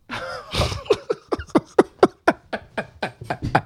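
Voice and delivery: airy unvoiced laughter; breathy laugh. Real, not acted. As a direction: laugh silently, all breath. Friend laughing at accusation.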